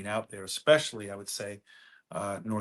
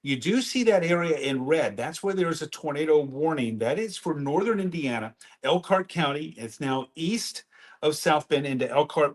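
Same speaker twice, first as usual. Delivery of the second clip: news anchor read; 3 semitones higher, 4 dB louder than usual. Acted. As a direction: open the weather report on air. On-air weather update.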